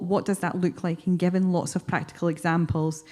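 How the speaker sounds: ordinary delivery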